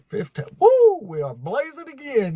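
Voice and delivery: sudden high whoop of delight; excited cheer. Real, not acted. Streamer whooping at his viewer count, excited. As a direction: cheer the number like a win.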